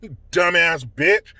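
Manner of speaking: ordinary delivery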